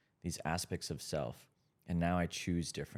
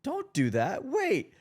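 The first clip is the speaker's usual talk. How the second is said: higher, louder retold warning to a friend; firm warning; 5 dB louder, slower than usual. Friend quoting the host's sudden warning, raised and higher. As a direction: cut them off with a sharp don't. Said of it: "You're like".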